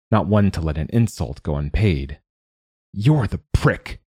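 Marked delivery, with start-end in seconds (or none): 2.9-4.0 slow, spiteful hiss; hissed retort. Acted. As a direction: drag the insult out with venom. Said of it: "Hart hissed".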